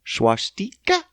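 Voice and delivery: swastika as shwah-STEE-kuh, rising; questioning long vowel. Shown as a demonstration. Testing the word swastika, long EE in the middle, questioning. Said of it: "Is it swastika or swastika?".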